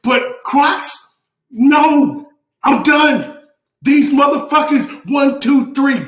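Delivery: high, emphatic, fed-up outburst; loud, indignant declaration; aggressive rant. Real, not acted. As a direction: punch out each phrase in disgust. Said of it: "It's the aggression for me".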